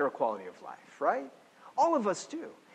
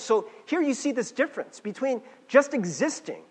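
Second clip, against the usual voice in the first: ordinary talk, nothing marked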